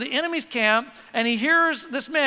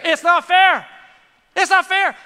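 emphatic preaching; 6 semitones higher, 8 dB louder than usual. Real, not acted. Preacher driving the point home.

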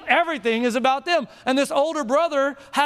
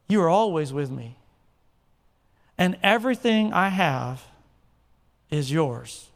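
low, hushed reading of the verses; solemn scripture reading; 6 semitones lower than usual. Acted. Pastor reading the father's reply, low solemn reading.

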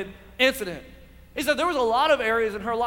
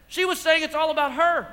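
loud, raised preaching; Martha's demand retold in a raised preaching voice; preaching at full force; 4 semitones higher, 5 dB louder than usual. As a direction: drive it home to the congregation.

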